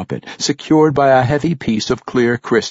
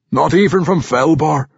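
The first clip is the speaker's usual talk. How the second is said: loud, high gruff dwarf scoff; gruff old dwarf warrior; 6 semitones higher, 4 dB louder than usual. Acted. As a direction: scoff like a crusty old veteran. Old warrior Ragged Dane voiced.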